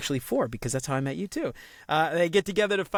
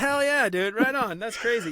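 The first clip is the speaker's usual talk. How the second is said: excited; 8 semitones higher than usual. Real, not acted. Host cheers about being born in the same hospital. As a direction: cheer it like great news. Shared birthplace surprise.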